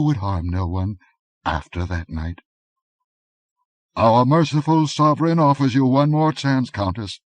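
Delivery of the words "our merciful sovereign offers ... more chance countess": higher, louder courtly menace; cold envoy. A narrator's reading. Masked envoy to the Countess, higher and louder menace. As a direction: offer mercy with a threat beneath. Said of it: "said the tallest of the three".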